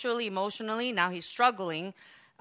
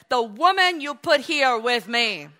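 blaming; 5 semitones higher, 9 dB louder than usual. Retold or imitated. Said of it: "we see the blame game".

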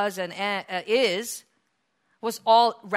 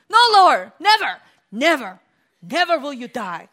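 emotional Peter; 2 semitones higher, 9 dB louder than usual. Acted. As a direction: blurt it out in alarm. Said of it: "he just emotionally reacts".

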